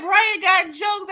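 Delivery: high, loud amused quip; amused exclaim. Retold or imitated. Recapping the show, delighted at Brian's joke. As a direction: tease him, delighted. Laughing retelling of own reaction.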